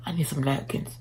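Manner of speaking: unvoiced low whisper. Real, not acted. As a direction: whisper it to yourself. Whispered aside.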